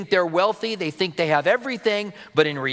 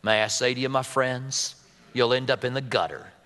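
J Vernon McGee drawl; 3 semitones lower than usual. Retold or imitated. Preacher imitating McGee's Southern drawl for the congregation. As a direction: lower Southern drawl, slow and folksy. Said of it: "Can you hear him?".

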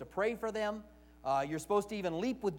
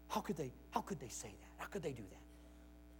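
much softer, hurt and indignant, slowing down; mock offended whine; 4 semitones lower, 14 dB quieter than usual. Preacher mocks Christians offended at a movie, softly whining. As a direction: sigh out the outrage in a wounded tone.

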